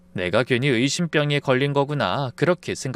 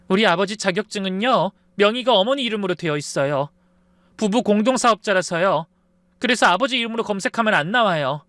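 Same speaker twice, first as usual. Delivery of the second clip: higher-pitched, hurt pleading; tearful pleading; 6 semitones higher than usual. A narrator's reading. Girlfriend explaining her job to boyfriend's mother, tearfully earnest. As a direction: explain yourself, a touch tearful. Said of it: "목소리가 울먹이더라고요".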